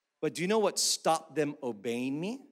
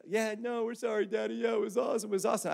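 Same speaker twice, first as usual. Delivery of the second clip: put-on higher, softer sheepish kids; meek little girls; 5 semitones higher, 5 dB quieter, faster than usual. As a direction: mumble it sheepishly. Daughters apologising in car.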